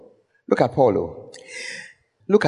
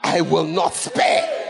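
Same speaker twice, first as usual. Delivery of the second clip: Paul as a Ghanaian preacher, higher, singsong Ghanaian lilt; Ghanaian way of speaking; 8 semitones higher than usual. Retold or imitated. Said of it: "a very Ghanaian way of speaking".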